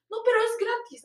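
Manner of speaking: high, soft mock coaxing; coaxing rose seller. Seller insists the rose is free, high soft coaxing. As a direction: imitate a seller insisting sweetly.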